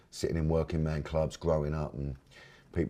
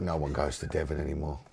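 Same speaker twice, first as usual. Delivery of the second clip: usual speaking voice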